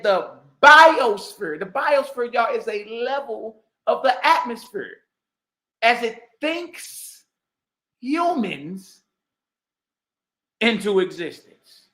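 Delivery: slow, deliberate mock recital; mocking quote. Quoting the debate line, slow and mocking. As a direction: lay each phrase out to ridicule it. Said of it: "He said".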